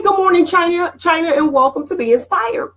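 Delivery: bright high call-out to a viewer; excited greeting. Real, not acted. Host hails a live viewer, excited.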